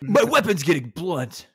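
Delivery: mock tough-guy callout; gruff hunter. Imitating the hunter sharpening his weapon, gruff. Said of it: "my guy is, like, gruff".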